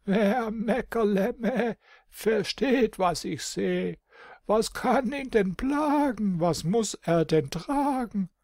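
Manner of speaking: put-on high, slow bleating old goat; pondering nanny goat, bleating meh. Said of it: "in tiefen Gedanken den grauen Kopf und sprach".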